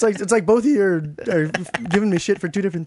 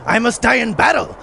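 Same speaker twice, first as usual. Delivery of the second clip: normal talking voice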